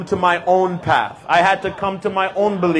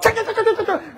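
mock Chinese chatter; 3 dB quieter, faster than usual. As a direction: mimic the airport crowd's chatter, high-pitched. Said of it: "And it was like".

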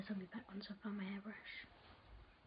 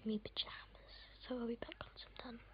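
hushed morning whisper. Real, not acted. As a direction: keep it to a whisper. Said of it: "I'm trying to be quiet".

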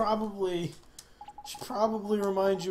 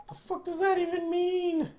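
incredulous; 13 semitones higher than usual. Real, not acted. Baffled reaction to game line.

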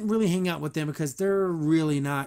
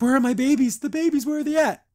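high, raised mock-frantic questions; hammed-up worry, pitched up; mock alarm; 7 semitones higher, 4 dB louder than usual. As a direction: act out a frantic search for missing babies. Retold waking to missing babies.

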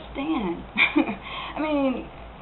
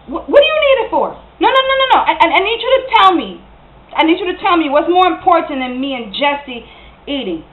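high, insistent questioning; alarmed, pleading confrontation; upset demand; 4 semitones higher than usual. Acted. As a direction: press Darnell for an answer, voice jumping up. Acted quarrel over missing food money.

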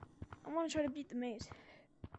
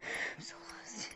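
slow, breathy, near-crying whisper; despairing whisper; 3 semitones lower, 6 dB quieter, slower than usual. Real, not acted. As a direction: sigh it out, defeated and lost. Lost in maze, despairing.

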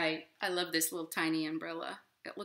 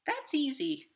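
higher chirpy little worm; cheerful tiny bug; inchworm; 9 semitones higher than usual. Acted. Inchworm answering the robin, higher and cheerful. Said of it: "said the inchworm".

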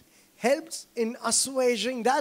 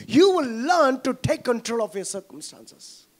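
louder, emphatic sermon lines; fervent preaching; 6 dB louder than usual. Acted. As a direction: drive the promise home to the congregation. Preaching at full force.

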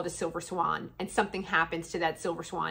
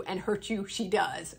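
ordinary talk

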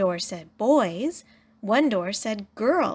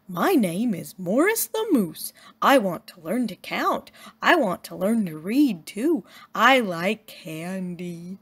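lumbering moose introducing himself. Acted. Morris the moose speaks.

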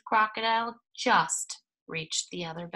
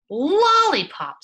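high, slow, drawn-out crocodile relishing the word; savoring crocodile; 9 semitones higher, 10 dB louder, much slower than usual. Acted. Crocodile savoring the offer of lollipops, slow and high. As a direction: stretch it out like a greedy crocodile tasting it. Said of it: "said the crocodile".

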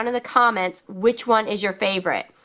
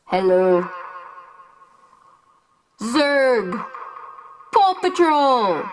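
echo effect; 4 semitones lower, slower than usual. Shown as a demonstration. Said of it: "Now let's change to echo".

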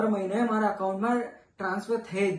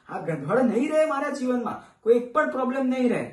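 higher, louder, insistent vow; emphatic resolve, pitched up; acted determination; 6 semitones higher, 4 dB louder than usual. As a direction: push it out as a firm promise to yourself. Vows to fix their life.

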